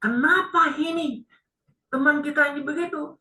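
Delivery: high-pitched, puzzled friends; acted astonishment. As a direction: voice the friends' baffled disbelief. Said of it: "Teman-temannya sangat heran". (meniru teman yang keheranan)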